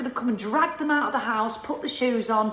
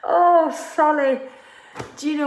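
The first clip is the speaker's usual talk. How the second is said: real laughing fit; 6 semitones higher, 6 dB louder than usual. Real, not acted. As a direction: crack up at your own lapse. Cracks up after losing her thread.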